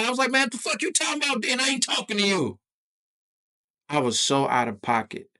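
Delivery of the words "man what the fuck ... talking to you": high, fast, heated retort; acted-out furious dismissal; angry shutdown. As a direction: shout him down in a high voice. Acted quarrel with cook.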